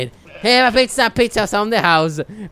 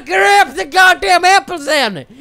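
angry yell; 7 semitones higher, 5 dB louder than usual. Real, not acted. Gamer yelling at lazy companion, angry shout. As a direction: shout it, fed up and loud.

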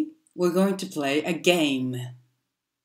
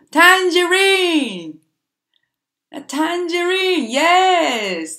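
much higher and louder, the word tangerine stretched out slowly; excited cheer; 10 semitones higher, 7 dB louder, slower than usual. Acted. Teacher cheering the class for naming the missing fruit, excited. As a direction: celebrate the right answer with a big, drawn-out shout.

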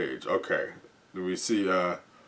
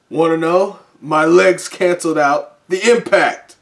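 loud, higher cocky shout; bold brag; 7 semitones higher, 8 dB louder than usual. Acted. Heroine brags in a fight.

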